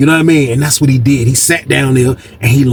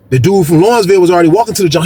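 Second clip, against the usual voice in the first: usual speaking voice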